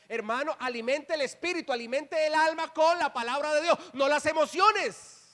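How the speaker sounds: voz de púlpito que sube en tono y fuerza; predicación enérgica. Real, not acted. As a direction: remata la exhortación con fuerza, más agudo. Predica con fuerza creciente. (prédica vehemente, en ascenso)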